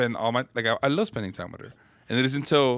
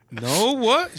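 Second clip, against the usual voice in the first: confused; 9 semitones higher than usual. Retold or imitated. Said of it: "And I'm like".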